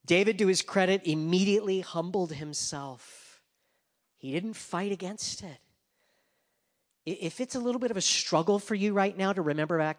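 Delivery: loud, high, slowing preacher's call; full-force preaching. Acted. Preacher drives home David's guilt, loud preaching. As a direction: preach it out over the congregation.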